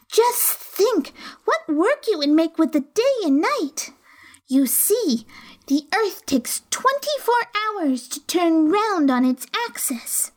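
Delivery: high, eager schoolgirl lecturing; eager know-it-all girl. A narrator's reading. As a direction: show off what you learned. Alice showing off knowledge.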